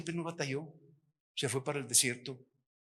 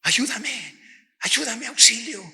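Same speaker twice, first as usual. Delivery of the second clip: higher and much louder desperate cry; crying for help; 10 semitones higher, 13 dB louder, slower than usual. Retold or imitated. A crying Japanese woman in the vision begging for help, in a loud desperate wail. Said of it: "La mujer estaba llorando y estaba diciendo".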